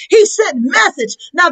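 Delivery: ordinary delivery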